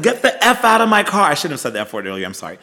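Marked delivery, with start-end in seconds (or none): 0.0-1.3 high, raised-voice order to get out; retold angry yell. Running at the man stealing her car, retold angry yell. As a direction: re-enact yelling at a thief.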